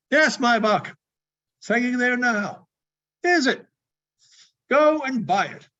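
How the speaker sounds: bursting with glee; gleeful Scrooge. Acted. Scrooge urging the boy, high and gleeful.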